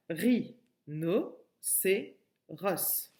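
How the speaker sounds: "ri, no, c'est ros" in widely spaced syllables. Shown as a demonstration. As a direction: pause after every syllable of "ri, no, c'est ros". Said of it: "la syllabe no".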